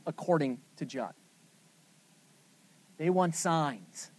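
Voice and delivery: ordinary talk, nothing marked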